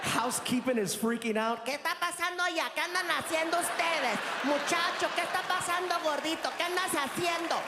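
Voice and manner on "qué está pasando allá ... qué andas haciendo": high, fast Spanish, alarmed; frantic housekeeper. Retold or imitated. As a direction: housekeeper yelling down the hall in alarm. Housekeeper freaking out.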